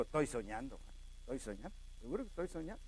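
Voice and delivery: hushed, low muttering to himself; acted soft, dazed aside; dreamy self-talk. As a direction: murmur it privately, as if half in a dream. Acts dazed Pedro talking to himself.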